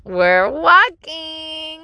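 high, very slow singsong; patient outside voice. Retold or imitated. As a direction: sing out each word for a toddler. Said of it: "But on the outside".

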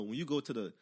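normal talking voice

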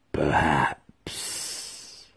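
ominous whisper. A narrator's reading. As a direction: let the word hang, barely breathed. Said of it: "ambiguity of that final word".

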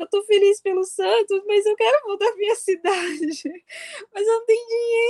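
high, teary sobbing plea; crying, pleading. Retold or imitated. Stranded fan sobbing to the policeman about getting home, crying. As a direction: wail it through tears, desperate and lost. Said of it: "eu só chorava, chorava, chorava".

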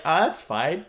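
lightly imitated Australian accent; casual brush-off in an amateur Australian accent. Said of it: "Was that your Australian accent?".

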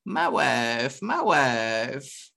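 mock Italian accent, repeated; lightly imitated Italian accent. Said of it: "in his funny Italian accent".

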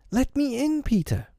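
high, tinkling fairy voice; lovely bell-like voice. A narrator's reading. Fairy visitor asking Peter to let her in, bell-like voice. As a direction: ring it out high like a little bell. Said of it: "spoke in a lovely bell-like voice".

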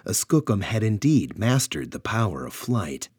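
usual speaking voice

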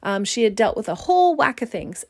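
ordinary delivery

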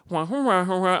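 muffled "wah-wah" nonsense talk; Charlie Brown lady. Retold or imitated. Girl yells at him unintelligibly, cartoon wah-wah imitation. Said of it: "I turned back into Charlie Brown, and she was the lady".

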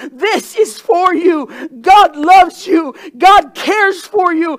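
slow, loud proclamation; slow shout. Acted. Preacher proclaiming God's love to the room. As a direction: shout each word slowly.

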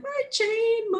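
very high, choked sobbing words; crying. Real, not acted. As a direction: try to speak through tears. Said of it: "I start to cry again".

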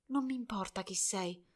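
very soft, weak and fading; faint voice. A narrator's reading. Dying girl answering Albert, very faint and soft. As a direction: speak so faintly he must lean in. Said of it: "con la voce così flebile".

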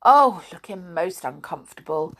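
ordinary delivery

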